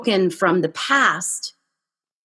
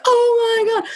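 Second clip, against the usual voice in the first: high mock panic; retold freak-out; 12 semitones higher than usual. Driver after hitting stone wall, high mock panic. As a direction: squeal it like you just hit a wall. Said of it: "freaking out, all upset".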